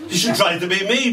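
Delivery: quick, laughing blurt. Acted. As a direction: spill it out fast.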